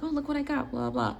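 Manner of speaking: put-on high, eager kid voice; thrilled teen showing mom her shopping, bright and eager; excited showing off.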